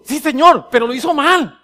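indignant "yes, but" excuse; self-justifying protest. Acted. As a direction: protest to God that the other one was wrong. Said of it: "Y empiezo a justificarme".

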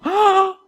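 high, loud "ah" of alarm; startled, acted cry; shocked gasp. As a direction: gasp as if caught out. Acts shocked discovery in reading.

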